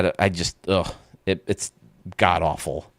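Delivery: normal talking voice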